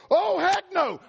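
very high, loud heck no; loud high-pitched oh heck no; outraged shout. Retold or imitated. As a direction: burst out in outrage. Dad leaps up outraged.

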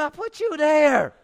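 high, loud, drawn-out scolding; hammed-up exasperated outburst; loud rebuke. Holy Spirit to the man who backed out, loud slow rebuke.

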